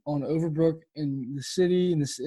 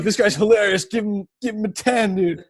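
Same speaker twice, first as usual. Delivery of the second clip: higher, louder bro cheer; rally the crowd to tip him; mock frat bro hype; 5 semitones higher, 5 dB louder than usual. Frat guy urging friends to tip, loud bro cheer.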